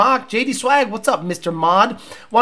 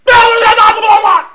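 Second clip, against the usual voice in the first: high, loud garbled shouting; excited yelling; 11 semitones higher, 7 dB louder than usual. Retold or imitated. Host mimics racer at an eight-second Cobra, wildly excited. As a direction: babble wildly as if overcome by the run. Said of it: "and he's like".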